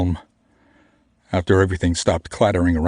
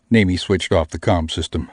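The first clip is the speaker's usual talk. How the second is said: ordinary delivery, nothing marked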